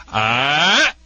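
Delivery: short sharp "Ah!" to startle a dog; a commanding "Ah!" meant to startle; startle sound. Shown as a demonstration. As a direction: bark out a quick correcting "Ah!". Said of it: "Make that my famous sound".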